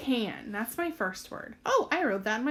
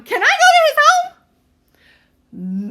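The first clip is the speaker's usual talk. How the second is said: much higher, louder and slow, as if excited; exclaimed wrong way; 16 dB louder, much slower than usual. Shown as a demonstration. Teacher demos the wrong excited reading to kids. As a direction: belt it out like an exclamation. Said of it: "that's not how I'm saying it".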